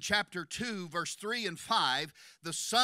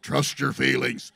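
lower, loud mocking mentor voice; scornful, lower voice quoting a film; mock Jedi voice; 7 semitones lower, 9 dB louder than usual. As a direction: sneer the movie line. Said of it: "That's the dumbest advice in the world".

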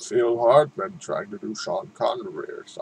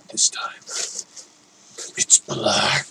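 whisper; slower than usual. Real, not acted. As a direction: hush it like a secret. Unboxing, whispered reveal.

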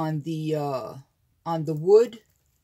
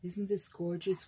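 ordinary talk, nothing marked

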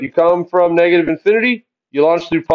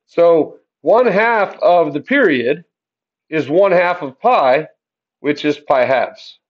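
slow, each step set apart; walk the class through it slowly; teacher spelling it out slowly; slow and deliberate; slower than usual. Shown as a demonstration. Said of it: "slow step-by-step explanation".

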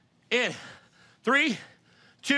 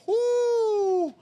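excited whoop. Real, not acted. Cheering the class on.

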